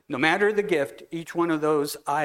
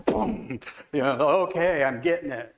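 louder, eager retelling of a sudden realization; excited aha; 6 dB louder than usual. Retold or imitated. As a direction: burst out like it just clicked. Woman retells her pen moment.

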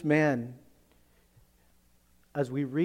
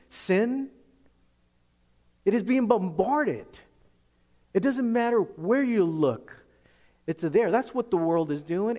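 high, loud, driving preacher's voice; preaching at full force; 5 semitones higher, 4 dB louder than usual. Acted. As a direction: pound it out like a fiery pulpit sermon.

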